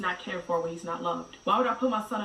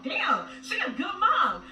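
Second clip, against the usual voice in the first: slow, higher mock admiration; mock impressed; 9 semitones higher, 3 dB louder, slower than usual. Mother mimicking viewers fooled by the online act, mock impressed. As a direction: fake being impressed. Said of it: "I'm like".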